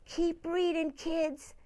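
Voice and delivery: usual speaking voice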